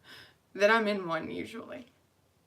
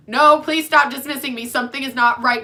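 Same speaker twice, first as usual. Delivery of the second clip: loud, high desperate plea; louder, higher retold cry of not being heard; pleading outburst; 5 semitones higher, 10 dB louder than usual. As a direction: beg them to finally listen. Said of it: "And I'm like".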